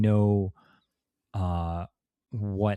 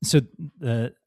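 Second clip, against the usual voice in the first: ordinary talk, nothing marked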